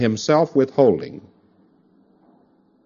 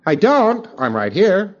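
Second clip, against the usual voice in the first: higher, louder protest; a thetan insisting he still exists; indignant surprise; 8 semitones higher, 3 dB louder, slower than usual. Retold or imitated. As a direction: object in disbelief. Thetan denies being dead.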